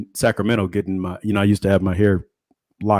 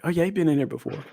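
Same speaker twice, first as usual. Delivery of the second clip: higher, softer, quicker imitated voice; casual vouching; 7 semitones higher, 6 dB quieter, faster than usual. Imitating the hairstylist vouching for the seller, casual. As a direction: vouch quickly in a light, high voice.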